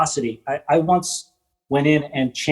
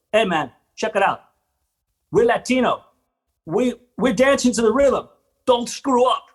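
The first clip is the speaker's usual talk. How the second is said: cocky dance captain; 7 semitones higher than usual. Retold or imitated. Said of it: "He goes".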